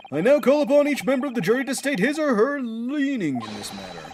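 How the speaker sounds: lightly voiced judge making a formal announcement. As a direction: address the jury ceremoniously. Judge calls for verdicts.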